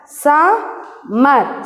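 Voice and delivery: "Summer" in two drawn-out beats with a pause between; split into syllables. Shown as a demonstration. Teacher showing first and second syllable, "Summer" split Sum-mer. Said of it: "this is first syllable".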